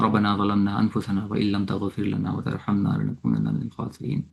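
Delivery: quick, quietly recited duas; closing prayer. Acted. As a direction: rattle off the closing prayers devoutly. (तेज़, धीमी आवाज़ में दुआ पढ़ना)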